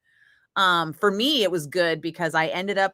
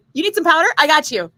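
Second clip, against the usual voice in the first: higher, louder, over-eager offer; eager fan helper; 9 semitones higher, 8 dB louder than usual. Retold or imitated. Fan working backstage offering the singer powder, over-eager and loud. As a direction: gush like a fan who'd do anything. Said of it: "I did not care what that was".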